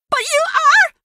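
high, loud exclamation; excited insistence. Acted. Excitedly contradicting the detective.

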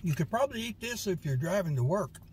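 chewing between words; talking mid-bite; mouth full of food. Real, not acted. Seen 4: Bites wrap at start, mouth works food while speaking throughout.

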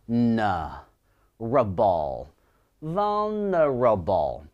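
"n", "rable", then "vulnerable", each slowly stretched; syllable by syllable. Shown as a demonstration. Teacher teaching pronunciation, "n, rable, vulnerable" slowly broken into syllables. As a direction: sound out "n, rable, vulnerable" in long, separated syllables. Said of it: "第二个音节叫".

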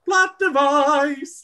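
high, loud, drawn-out mock fanfare; vocal spirit fingers. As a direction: sing it out like jazz hands. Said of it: "vocal equivalent of spirit".

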